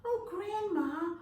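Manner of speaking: put-on high, slow wondering child; amazed little girl asking slowly. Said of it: "she said".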